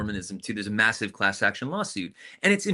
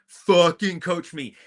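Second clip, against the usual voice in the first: shouted demand; 6 semitones higher, 6 dB louder, slower than usual. Retold or imitated. Demanding parents coach her hard, slow raised shout. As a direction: repeat "Fucking coach me" higher, louder and slow. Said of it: "And I said".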